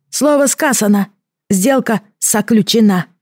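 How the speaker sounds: high exulting creature voice; triumphant creature. Acted. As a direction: proclaim the deal as a creature. Creature seals the deal.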